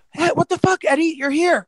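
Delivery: high, startled what the fuck; imitating a surprised roommate; mock shocked roommate. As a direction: act stunned to see him. Roommate shocked to see twin.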